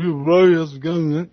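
lazy South Island New Zealand accent. Shown as a demonstration. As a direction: barely move the jaw, South Island style. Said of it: "the South Island was like".

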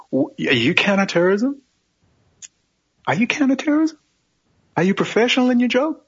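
high, rising disbelieving questions; mock incredulity. Imagined questioning of counterterrorism officers, mockingly incredulous. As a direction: pitch each question up in sarcastic disbelief.

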